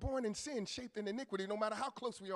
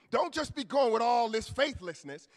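ordinary talk, nothing marked

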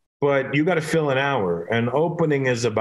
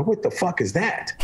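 slow, higher-pitched disbelief; mock astonishment; 7 semitones higher than usual. Comedian spotting a massive bodybuilder in the crowd, mock astonished. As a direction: act stunned by the sight. Acted shock at huge bodybuilder.

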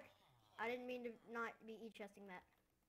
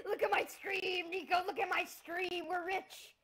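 higher, louder, laughing and calling out; giddy excitement; 7 semitones higher, 8 dB louder than usual. Real, not acted. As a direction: shout it with giddy glee. Found rich village, calling friend.

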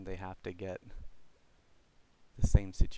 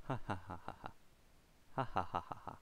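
soft laughing; 10 dB quieter, much faster than usual. Real, not acted. Continued quiet laughter.

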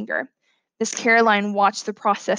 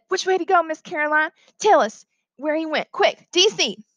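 high-pitched urgent schoolboy; eager helpful boy; 6 semitones higher than usual. Acted. Boy asks teacher where it went, urgent high voice. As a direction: raise the voice in pitch, urgent to help.

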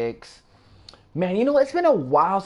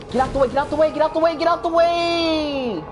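frantic shout; 13 semitones higher than usual. Real, not acted. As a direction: yell at them to move. Enemies swarming, shouting.

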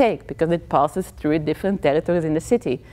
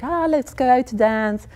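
higher-pitched imitated come-on; men's come-on; 6 semitones higher than usual. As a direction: coax like men asking for a dance. Men asking women to dance.

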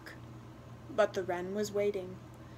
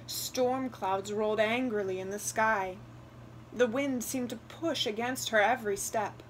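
ordinary delivery, nothing marked

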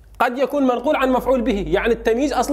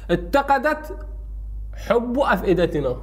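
spaced out example; slower than usual. Shown as a demonstration. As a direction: dictate اتقدت, pause, then حب أفئدتنا. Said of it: "أحطه بجملة جديدة". (نطق متقطع بوقفة بعد اتقدت)

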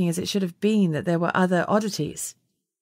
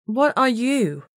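slowly; 6 semitones higher, slower than usual. A narrator's reading. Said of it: "I asked slowly".

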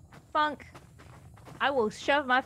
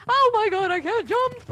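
panicked; 8 semitones higher, 5 dB louder than usual. Real, not acted. Fleeing the monster in game.

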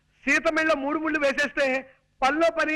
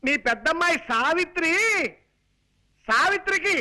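raised, projected delivery in short bursts; 3 semitones higher than usual. Acted. Man tells old aunt about a match, projecting. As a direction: proclaim it for someone hard of hearing. Shouting news to hard-of-hearing aunt.

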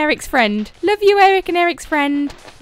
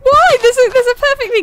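incredulous why; 6 semitones higher, 6 dB louder, faster than usual. Real, not acted.

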